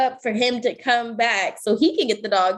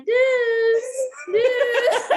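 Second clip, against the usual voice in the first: high call across the street; calling the dog; 11 semitones higher than usual. Retold or imitated. Calling for the lost dog on the block, high call.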